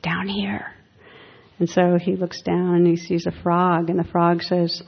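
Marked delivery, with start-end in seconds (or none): 0.0-0.7 soft unvoiced psst from the ground; frog's whisper. Frog in the pond calling to an old man, whispered. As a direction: whisper up at him like a hidden frog. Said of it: "there's this little sound".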